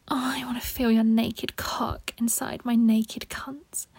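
normal talking voice

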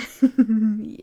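short burst of teasing laughter. Acted. Mommy laughing at her partner's reaction, teasing laugh. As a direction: laugh at him, amused and in control.

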